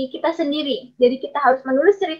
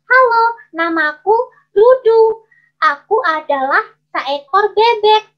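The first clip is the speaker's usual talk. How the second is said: high, loud little duck voice; squeaky-small storybook duck, drawn-out words; 8 semitones higher, 6 dB louder than usual. Shown as a demonstration. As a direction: introduce yourself slowly as a tiny high-pitched duck. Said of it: "Kalau suara kecil itu".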